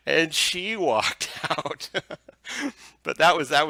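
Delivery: laughing through the words; breaking up with laughter mid-line; amused, laughing while telling it. Real, not acted. Laughing at the story.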